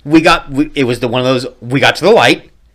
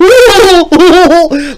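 high burst of laughter; loud laugh; 14 semitones higher, 6 dB louder than usual. Real, not acted. Laughing at the memory.

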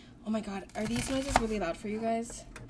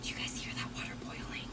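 soft whisper to the listeners; hushed whisper; 11 dB quieter than usual. Real, not acted. Cook showing listeners the boiling water, hushed whisper. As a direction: whisper it so the background sound comes through.

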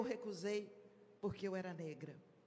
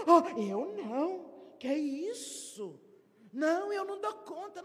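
flustered refusal; 4 semitones higher, 5 dB louder than usual. Retold or imitated. Member refusing to pray in the meeting, flustered. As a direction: shrink back from the task.

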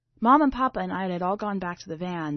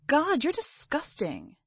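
disgusted sister; 7 semitones higher than usual. Acted.

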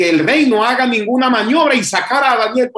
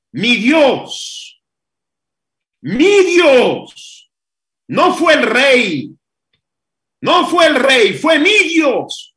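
building proclamation; 4 semitones higher, much slower than usual. Acted. Daniel proclaims from den.

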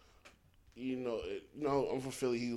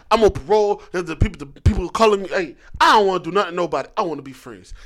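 half-hearted imitation of a nervous rapper; mock loud plea; 10 dB louder than usual. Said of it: "he's like".

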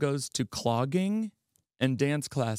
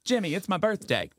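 higher-pitched imitation of a grandma; retold grandma; 6 semitones higher than usual. Grandma telling a celebrity about her birthday, lightly acted announcement. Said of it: "my grandma was like".

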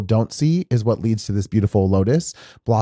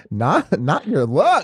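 laughing tease; 6 semitones higher, slower than usual. Real, not acted. Joking about ruined makeup.

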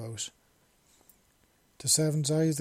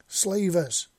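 much louder, higher call across distance; yelled; 6 semitones higher, 7 dB louder than usual. Acted. Seven yells across to his friends, loud call. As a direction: call it out to the others. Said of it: "he yelled over to them".

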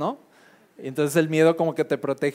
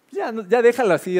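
Marta giving up; 7 semitones higher, 4 dB louder than usual. Retold or imitated. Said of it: "era lo que le dijo Marta, así como de".

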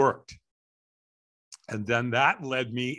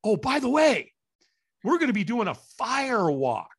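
much higher, breezy offhand tone; casual bombshell; 9 semitones higher than usual. Retold or imitated. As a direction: drop the big news like it's nothing. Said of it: "he goes".

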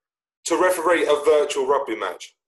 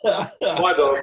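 laughing, higher put-on voice; disguised voice; 4 semitones higher than usual. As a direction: try a fake voice, giggling. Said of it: "I can't do your normal voice".

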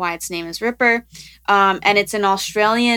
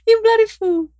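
very high-pitched imitation duck voice; talking duck impression; 13 semitones higher than usual. Shown as a demonstration. Host imitates the recorded talking duck for co-host, high duck voice. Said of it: "that's a really good impression".

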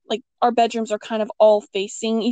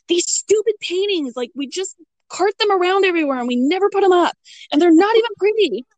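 high-pitched, retold exasperated rant; mad griping; 8 semitones higher, faster than usual. Woman griping about grandmother's paintings after hurting her hand, mad. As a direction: re-enact griping about something stupid, pitched high and rushing. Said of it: "I'm mad".

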